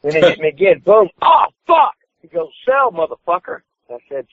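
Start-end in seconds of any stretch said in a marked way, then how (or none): 0.8-1.9 high-pitched mock yell; retold pained shout. Rookie getting hit in the ring, shouting in mock pain. 2.6-3.6 higher-pitched imitated command; retold urgent order. Opponent telling the rookie to sell, imitated urgent order.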